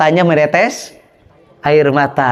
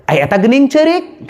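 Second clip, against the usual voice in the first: voicing the king; 6 semitones higher than usual. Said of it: "Cek raja teh".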